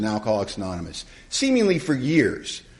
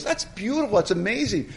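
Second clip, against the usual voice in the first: much higher pitched mock delight; delighted sponsor; 10 semitones higher than usual. Sponsor hearing of the newcomer's return, delighted. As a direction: gush like a thrilled sponsor, pitched high.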